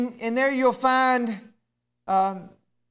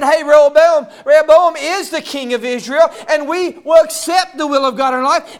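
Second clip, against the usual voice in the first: loud proclaiming shout; the faithful proclaiming their king aloud; declaring loudly; 3 semitones higher, 8 dB louder than usual. Acted. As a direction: call out the faithful's vow to the rafters. Voicing the faithful tribe's declaration.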